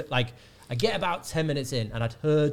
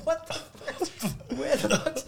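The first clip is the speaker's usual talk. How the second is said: high, slow stunned outburst; shocked; 14 semitones higher, slower than usual. Retold or imitated. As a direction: reel in disbelief. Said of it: "And I was like".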